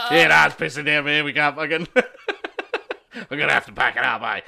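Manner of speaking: loud mock phone call with laughter breaking in; loud phone excuse. Faking rain on the phone to the office, loud excuse. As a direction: raise your voice as if reporting a downpour. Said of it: "he's on the phone to the office going".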